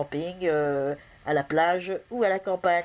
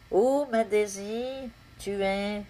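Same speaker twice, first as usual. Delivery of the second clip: drawn-out poetic opening; poem recital; 3 semitones higher than usual. Acted. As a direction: begin with a long, raised "Oh". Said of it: "vous dire un poème".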